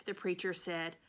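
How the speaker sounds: usual speaking voice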